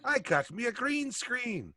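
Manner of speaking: high, slow Irish leprechaun lilt; hammed-up Irish leprechaun voice. Shown as a demonstration. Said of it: "You sound like a fucking leprechaun".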